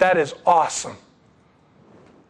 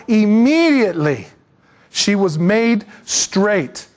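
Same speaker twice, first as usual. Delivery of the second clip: slow, weighty words; slow emphatic; slower than usual. Acted. Preacher to congregation, slow and weighty. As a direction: lean on each word slowly.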